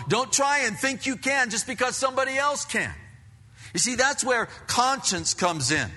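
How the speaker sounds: high, loud driving sermon; fervent preaching. Acted. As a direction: press the warning home to the church. Preaching at full force.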